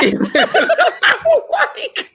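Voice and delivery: high laugh through the words. Real, not acted. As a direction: laugh while you speak.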